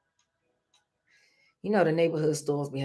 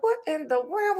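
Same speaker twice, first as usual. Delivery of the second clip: surprised; 9 semitones higher than usual. Retold or imitated. Sisters find teeth on street.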